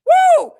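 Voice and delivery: high, loud whooping woo. Real, not acted. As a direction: let out a big woo. Exclaiming again.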